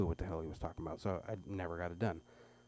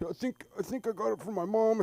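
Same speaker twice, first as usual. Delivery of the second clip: mock whiny kid; 12 semitones higher, 5 dB louder than usual. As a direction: whine it at dad. Son voice.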